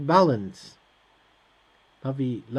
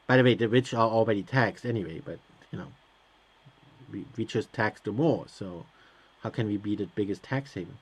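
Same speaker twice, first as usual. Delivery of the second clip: softer, half under the breath, partly voiceless; muttering; 7 semitones lower, 7 dB quieter, faster than usual. Real, not acted. Player quietly disputing the game's tax claim, muttering. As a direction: grumble to yourself.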